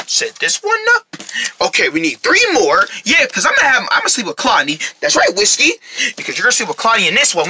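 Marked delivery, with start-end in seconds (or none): 0.1-1.1 squeaky high voice. Acted. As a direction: pitch it way up. Same words, high voice.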